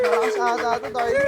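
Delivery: high, excited shout at the power; a high, thrilled yell. Real, not acted. Excited at the scooter's sound.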